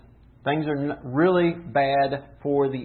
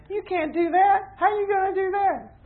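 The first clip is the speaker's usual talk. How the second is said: much higher scoffing voice of the crowd; doubting naysayers; 3 dB louder than usual. Retold or imitated. Preacher mimics naysayers doubting his obedience, high scoffing voice. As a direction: mimic the doubters mocking you. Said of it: "everyone around me is saying".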